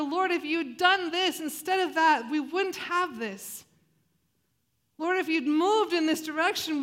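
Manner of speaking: pleading complaint. Pretend. Voicing listeners' complaints to God.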